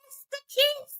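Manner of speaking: mocking Simone. Mocking Simone as Roger Rabbit, high squeaky voice. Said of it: "so Roger Rabbit like".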